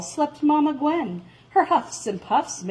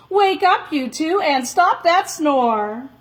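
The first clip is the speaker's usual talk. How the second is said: loud call; 5 semitones higher, 4 dB louder than usual. Acted. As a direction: call out loud to wake her. Calling to the twins.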